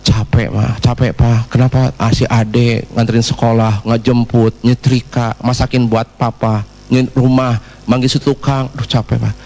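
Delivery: low, drawn-out mock weary complaint; tearful weary wife. Crying wife lists her chores to husband, low and weary. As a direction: play an exhausted wife listing chores in a low voice. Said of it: "Kenapa sih, Mah, kamu nangis?".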